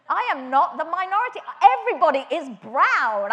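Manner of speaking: loud, elated exclaiming; bursting with joy at fitting in; excited. Retold or imitated. Arriving in Sri Lanka, loudly thrilled to fit in. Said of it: "I'm so excited".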